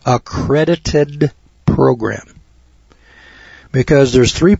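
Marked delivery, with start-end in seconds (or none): 0.0-2.3 "accredited program" slow and deliberate, each word weighed; emphasis on accredited program. Shown as a demonstration. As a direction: lean on "accredited program", slowly. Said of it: "Underline the word".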